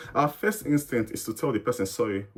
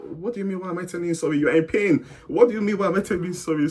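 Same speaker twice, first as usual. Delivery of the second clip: higher-pitched exasperated comeback, louder toward the end; mock frustration; 7 semitones higher, 4 dB louder, faster than usual. Recalling his reaction to being questioned for saying sorry, mock frustration. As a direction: act out baffled frustration, pitched up. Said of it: "it can be frustrating".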